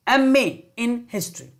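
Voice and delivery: एमए said slowly, starting on a vowel; sounding out एमए. Shown as a demonstration. Teacher to class, drawing out एमए slowly. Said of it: "मैं जो बोल रहा हूं उसको ध्यान सुनेंगे".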